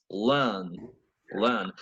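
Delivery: learn with the r dropped, British. Shown as a demonstration. Teacher contrasting American and British learn, British. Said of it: "For a British".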